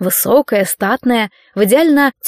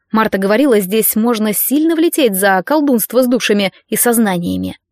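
usual speaking voice

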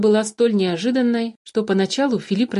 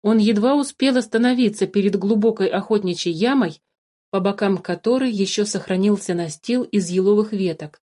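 usual speaking voice

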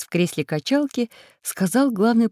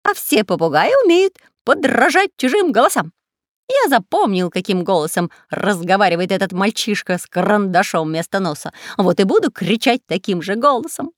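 shouting parrot; 3 semitones higher, 5 dB louder than usual. Acted. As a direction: squawk it out eagerly. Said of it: "пояснил верный попугай".

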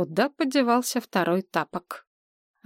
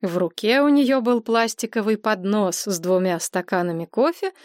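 usual speaking voice

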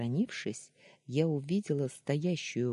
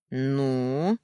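loud, long, growled prompt; threatening growl; 7 dB louder than usual. A narrator's reading. Daniil pinning heroine to the wall, threatening growl. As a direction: draw it out menacingly. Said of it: "прорычал он".

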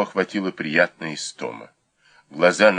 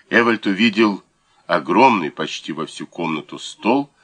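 plain reading voice

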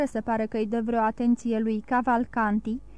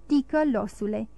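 usual speaking voice

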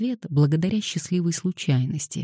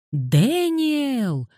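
higher, desperate call stretched out; desperate wail; 11 semitones higher than usual. Acted. Girl wailing after the fleeing boys, desperate. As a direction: wail after him as he escapes. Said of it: "крик перерос в отчаянный вопль".

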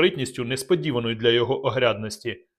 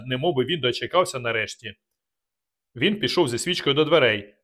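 usual speaking voice

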